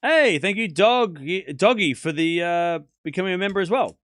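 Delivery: loud, high, bursting thank-you; real delight, thrown out loud and high; excited thanks. Real, not acted. Streamer thanking a new member, loud and excited.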